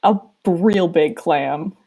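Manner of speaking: ordinary delivery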